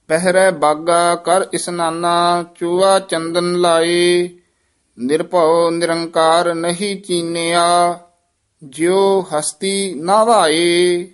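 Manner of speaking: measured chant with held line endings; scripture recitation. Acted. Teacher models Gurbani for students, measured chanted recitation. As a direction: recite evenly, pausing between phrases.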